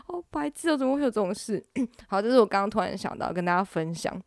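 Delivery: normal talking voice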